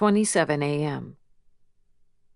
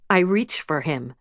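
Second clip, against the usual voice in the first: ordinary delivery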